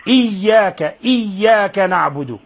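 إياك with a strong, held shadda; firm doubled consonant. Shown as a demonstration. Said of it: "شد عليها قليلا".

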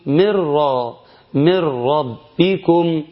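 "من ربكم" slow, noon vanished into a doubled ra; Quranic idgham. Shown as a demonstration. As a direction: recite slowly, swallowing the noon into the ra. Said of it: "ونقول". (إدغام النون في الراء بتلاوة بطيئة)

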